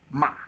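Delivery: short "ma" falling from high to low; brief, falling "ma"; fourth tone. Shown as a demonstration. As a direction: drop "ma" quickly from the top. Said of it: "high to low".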